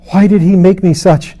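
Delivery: cried out high and loud in anguish; a tormented soul crying out her grievance; anguished gasp. Acted. Lilith cries to Mara in torment, high and loud. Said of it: "gasped Lilith".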